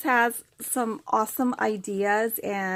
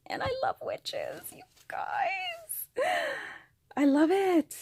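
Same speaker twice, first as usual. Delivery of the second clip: much higher, delighted gushing; gushing squeal. Real, not acted. Telling viewers she loves witches, gushing in delight. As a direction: squeal it like a thrilled fan.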